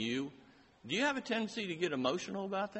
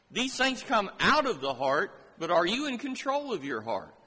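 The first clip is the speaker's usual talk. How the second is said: raised, higher, louder preaching voice; fiery preaching; 5 semitones higher, 4 dB louder than usual. Acted. Preacher challenging the congregation, raised and insistent. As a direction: press each question on the congregation.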